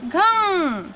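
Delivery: घंग held with the nasal ng ringing; drawn-out teaching demo. Shown as a demonstration. Teacher demonstrating घंग to students, slow and clear. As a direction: sound out घंग for the class. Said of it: "अंग की मात्रा".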